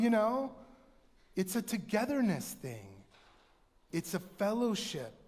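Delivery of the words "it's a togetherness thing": usual speaking voice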